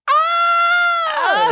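very high shrill scream of pain; retold shriek. Girl hit by a rubber band, piercing high scream. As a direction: shriek like you just got hit in the face. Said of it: "She goes".

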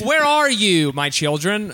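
badly faked American accent in pop-punk style. Mother calling for her children, mock Blink-182 voice. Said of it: "trying to do the Blink one eighty-two voice".

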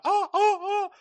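very high mock "oh, oh" of shock; mocking someone acting surprised; mock surprise. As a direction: parody a fake surprised gasp. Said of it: "don't act surprised".

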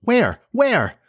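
put-on high, excited child's question; excited high-pitched searching; eager asking. A narrator's reading. Children eagerly seeking the island.

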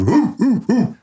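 high imitated dog woofs; dog barking. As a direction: bark like a dog in high hoots. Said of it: "Bowser goes".

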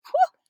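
loud high whew; a big relieved whew; loud high exclaiming whew. Real, not acted.

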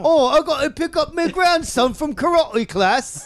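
high mock English grandmother; hammed-up English accent. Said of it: "she had an English accent".

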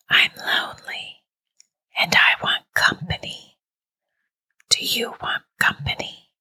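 lonely, luring, voiceless; eerie whisper. A narrator's reading. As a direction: whisper an invitation from a phantom caller. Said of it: "it was a mere whisper".

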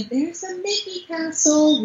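high, drawn-out imitation of a small child; slow, high-pitched kiddie voice; little boy's voice. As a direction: imitate a small son spotting something wondrous. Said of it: "to hear his little voice say".

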